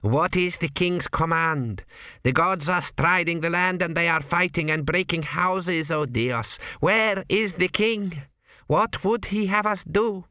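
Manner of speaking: high, slow pleading priest voice; anxious high priest. A narrator's reading. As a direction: beg the king for orders.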